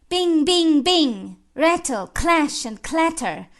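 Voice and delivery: high-pitched, ringing imitation of alarm bells; ringing bell cry. Reciter imitates fire bells and clatter, high ringing cry. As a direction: call the clangs out dramatically.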